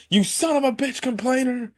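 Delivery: high-pitched retold outburst; mock outrage. Retelling an argument over a destroyed house, mock outrage. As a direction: squeal the insult in mock anger. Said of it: "she's also even more pissed".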